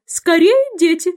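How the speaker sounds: high, loud mother-bird call; trembling canary. A narrator's reading. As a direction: call the chicks urgently, voice trembling. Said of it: "канарейка дрожащим голосом звала своих птенчиков". (дрожащим голосом звать птенчиков)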